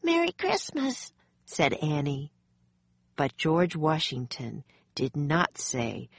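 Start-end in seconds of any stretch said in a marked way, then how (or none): 0.0-1.1 put-on very high little-girl voice; high-pitched, innocent holiday greeting; cheery girl's greeting. A narrator's reading. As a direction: chirp it in a high child's voice. Child Annie greets stern commander.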